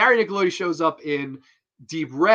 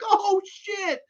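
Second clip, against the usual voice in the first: much higher, startled mock gasp of shock. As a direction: react to the monster jumping out. Viewer's shocked reaction.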